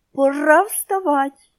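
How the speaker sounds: slow, high squawky parrot, almost crying; a lost parrot calling out, near tears; tearful parrot voice. A narrator's reading. Lost parrot calls the wake-up words, almost crying. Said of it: "і мало не плачучи".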